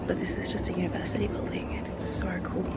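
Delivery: hushed whisper in a quiet building. Real, not acted. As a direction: whisper it, awed. Inside quiet university building.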